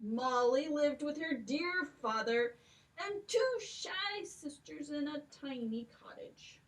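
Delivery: ordinary delivery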